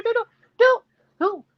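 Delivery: high, punched doo-doos; imitated dashing noise. Mimics Karen dashing about.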